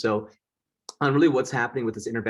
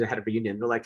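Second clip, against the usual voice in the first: ordinary talk, nothing marked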